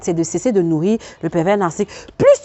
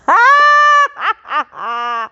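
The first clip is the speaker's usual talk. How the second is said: long high squeal breaking into laughter; gleeful cackle; 13 semitones higher, 4 dB louder than usual. Real, not acted. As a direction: let a high laugh ring out. Gleeful laugh cutting off the sentence. (long éclat de rire aigu)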